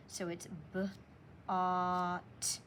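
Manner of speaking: bought split into b, aw, t; segmenting sounds. Shown as a demonstration. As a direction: sound out bought one phoneme at a time. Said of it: "It's only three phonemes".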